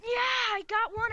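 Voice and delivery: high, loud cheer; thrilled gamer, pitch way up; excited triumph. Real, not acted. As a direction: crow over a hit in the game. Scores a hit in game.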